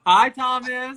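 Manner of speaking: high, loud greeting; calling out. Real, not acted. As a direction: call out happily to someone across the room. Greeting someone far off.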